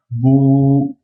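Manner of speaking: "bou" held long and level; prolonged ou. Shown as a demonstration. Teacher demonstrating the long vowel waw, "bou" drawn out long. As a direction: sustain the ou in "bou". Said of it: "C'est pour prolonger le ou".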